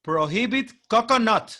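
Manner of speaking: high, loud, slow mock English "prohibit coconut"; hammed-up English read of a bad sign. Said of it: "pero mira en inglés". (lectura burlona en inglés, alta y lenta)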